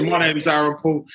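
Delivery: normal talking voice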